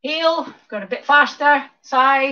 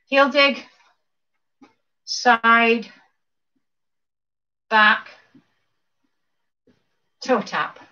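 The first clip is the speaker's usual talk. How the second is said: slow count-through; much slower than usual. Shown as a demonstration. Instructor walking class through dance steps slowly. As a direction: step cues spaced out with long pauses. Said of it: "do it one more time slowly".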